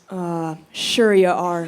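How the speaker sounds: slow doubtful nurse, lightly voiced; mock disbelief. Nurse doubting mom's Hall of Fame claim, slow sceptical drawl. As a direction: humor her with slow doubt. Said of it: "every nurse goes".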